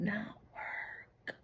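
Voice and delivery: slow gloating whisper. Real, not acted. A tarot reader whispering that the ex's voodoo failed. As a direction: breathe it out slowly, savoring their failure.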